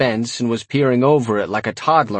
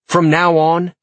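firm, higher-pitched command; angry; 7 semitones higher, 4 dB louder, slower than usual. A narrator's reading. Narrator to his imp about rules for attacks, angry. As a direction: lay down the law angrily. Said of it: "I said angrily".

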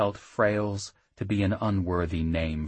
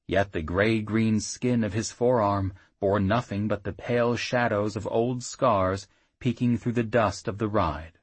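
plain reading voice

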